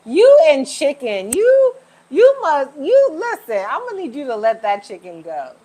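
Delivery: hammed-up telling-off, ending calmer; mock scolding. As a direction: pretend to scold a viewer over chicken. Playfully scolding a chicken lover.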